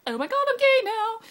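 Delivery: high, loud hammed-up freak-out; mock panic. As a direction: fake a shrieky, panicked realization. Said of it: "you'll be like".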